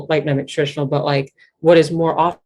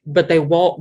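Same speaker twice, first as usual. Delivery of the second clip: ordinary delivery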